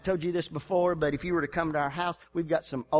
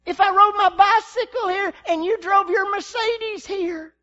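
loud, higher, slow and driving; preaching shout; 9 dB louder than usual. Acted. Pastor declaring to the congregation, shouting. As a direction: preach it at full force.

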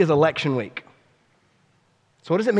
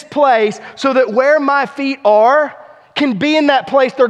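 preaching at full force; 8 semitones higher, 7 dB louder than usual. Real, not acted. Pastor pleading with congregation, impassioned loud preaching. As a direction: cry out for the king to come back. Preacher calls for Christ's return.

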